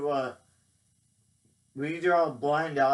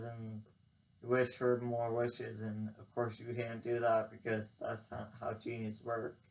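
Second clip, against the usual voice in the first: ordinary delivery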